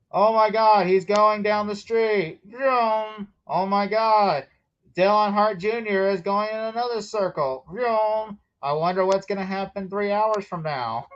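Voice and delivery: hammed-up slow-motion awe; mock race commentator. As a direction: sarcastically gush over cars going in circles. Mocking a dull race broadcast as thrilling.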